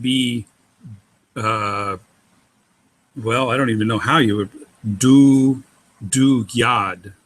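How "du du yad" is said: "du yad" with an oo vowel replacing the w; hypothetical mispronunciation. Shown as a demonstration. Teacher testing Vav as a vowel, halting mispronunciation. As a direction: try out "du yad" haltingly, drawing out the du. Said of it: "would be the way to pronounce that".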